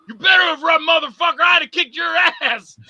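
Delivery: high, loud empty threat; blustering shout. Acted. Beaten man yells once the monster is gone, blustering shout. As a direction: yell after him with false bravado. Bravado shouted after attacker left.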